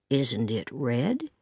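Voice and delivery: shy farmer asking gently. Acted. Matthew shyly answering Anne, low and slow.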